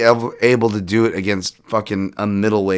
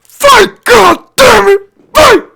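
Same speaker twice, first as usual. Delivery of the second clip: high, much louder screaming father; yelling dad; 12 dB louder, much slower than usual. Retold or imitated. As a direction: scream at him from the corner like an angry dad. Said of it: "you just hear his dad go".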